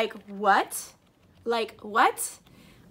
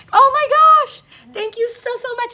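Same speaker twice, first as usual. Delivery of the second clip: high, loud cry of joy easing into thanks; overwhelmed with gratitude; 11 semitones higher, 10 dB louder than usual. Real, not acted. Vlogger thanking her best friend for gifts, happily overwhelmed. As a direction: burst out, then gush thanks near tears. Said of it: "I'm seriously gonna cry".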